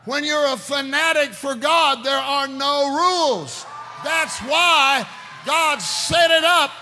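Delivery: loud, slow, ringing sermon voice; fiery preacher calling out; full-force preaching. Acted. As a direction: preach it out to the whole church.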